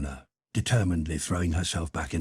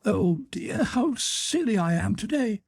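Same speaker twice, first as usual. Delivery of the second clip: tearful. A narrator's reading. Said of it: "with tears in her eyes".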